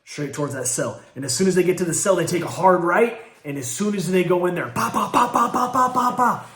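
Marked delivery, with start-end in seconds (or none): 4.7-6.5 punch sounds. Retold or imitated. Imitating punches in a beating.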